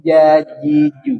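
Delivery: "Jajiju" slow, syllable by syllable, rising at the end; slow syllable drill. Shown as a demonstration. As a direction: model "Jajiju" slowly for the student. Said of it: "itu masih jajiju". ("Jajiju" pelan, naik di akhir)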